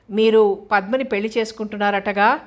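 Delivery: ordinary delivery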